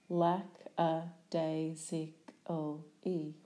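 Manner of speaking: "Lackadaisically" slowly, syllable by syllable; split into syllables. Shown as a demonstration.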